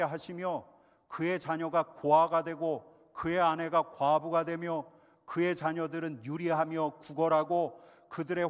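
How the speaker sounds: loud, forceful psalm recitation; loud scripture reading. Acted. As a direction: proclaim the curse psalm loudly. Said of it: "같이 읽겠습니다". (힘차게 크게 시편 낭독)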